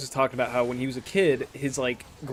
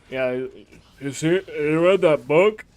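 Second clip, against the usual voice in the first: imitating crazy grandpa; 2 semitones higher, 6 dB louder, slower than usual. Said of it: "And Grandpa said".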